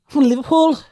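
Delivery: Liverpool accent. Shown as a demonstration. Asked by mates to do a Liverpool accent, put-on Scouse. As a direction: have a go at Scouse. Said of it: "do a Liverpool accent".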